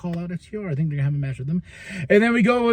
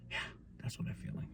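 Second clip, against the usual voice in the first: a soft aside, no voice; whispered echo; 23 dB quieter, much faster than usual. Real, not acted. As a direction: whisper it to yourself. Echoing own remark.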